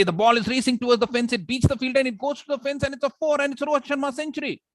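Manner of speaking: high, racing cricket commentary; excited play-by-play at full tilt; fast action commentary. Shown as a demonstration. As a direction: race through it, pitch climbing to a peak. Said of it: "speak fast when there is action".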